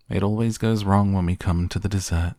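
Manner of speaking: ordinary delivery, nothing marked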